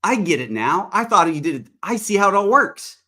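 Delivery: imitated delighted "oh, I get it"; sudden realization. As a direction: retell it as an excited lightbulb moment. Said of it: "a light bulb went off on her head".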